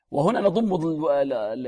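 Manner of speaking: normal talking voice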